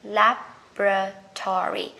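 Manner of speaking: slow syllable-by-syllable "Laboratory", LAB-ra-tory; American pronunciation. Shown as a demonstration. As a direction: sound out American "Laboratory" slowly. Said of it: "Người Mỹ sẽ đọc là".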